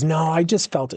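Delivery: usual speaking voice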